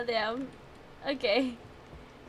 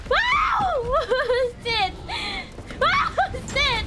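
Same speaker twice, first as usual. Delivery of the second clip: panicked shout; 8 semitones higher, slower than usual. Real, not acted. Panicking as monster attacks.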